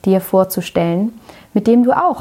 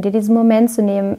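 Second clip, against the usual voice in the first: ordinary talk, nothing marked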